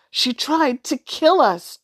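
high, loud and slow protest; a scared boy shouts a warning at his friend; shouted. Acted. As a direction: shout it after her, alarmed. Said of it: "Drew shouted".

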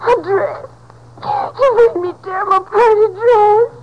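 sobbing, aggrieved cry; crying and blaming him; tearful wail. Acted. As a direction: wail over it like a hurt child. Girl wails over ruined dress.

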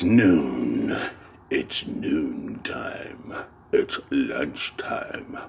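slow, soft whisper, talking to himself. Real, not acted. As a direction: breathe it out slowly and softly. Musing to himself about time.

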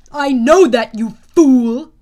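very loud, booming rebuke. A narrator's reading. General rebuking his underling, booming. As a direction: thunder it out at a fool. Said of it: "boomed the man".

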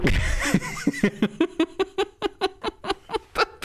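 real laugh breaking the sketch; high laugh. Real, not acted. Breaks out of the roleplay.

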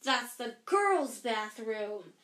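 slow, scandalised exclamation; gasped in shock. A narrator's reading. Prefect catches boys at the girls' bathroom, shocked. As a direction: draw it out aghast, leaning hard on girls'. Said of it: "he gasped".

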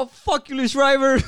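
high, loud joking insult; hammed-up heckle at someone walking away; mock outburst. Coworker curses the actor walking off, mock outburst.

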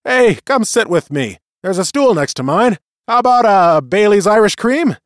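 loud, high, jovial voice; hearty bar friend. A narrator's reading. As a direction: call him over, loud and jolly. Friend calls Bailey over.

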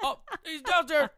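high alarmed yell; excited shout. Retold or imitated. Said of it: "Mike's like".

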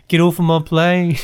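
British take on the plane line; a quick British Harrison Ford quote. Pretend. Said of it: "British voice with a bunch of Harrison Ford lines".

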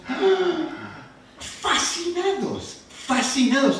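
high gasp, then drawn-out fascinated; imitating kids' amazement. Father retelling how his kids gasped at the photo, high. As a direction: play the kids' wonder, high and slow.

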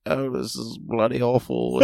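lightly voiced British grumble; British accent. Shown as a demonstration. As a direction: quote Kara in a hammed-up British accent. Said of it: "What would British Tim sound like?".

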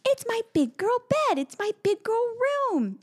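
put-on very high proud little-girl voice; high delighted child claiming her room; excited toddler. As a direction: squeal like a thrilled toddler. Daughter quoted excited.

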